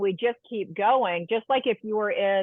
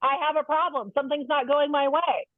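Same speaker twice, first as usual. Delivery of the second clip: voicing the mad feeling; 6 semitones higher than usual. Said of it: "my favorite feeling is actually mad because it actually says".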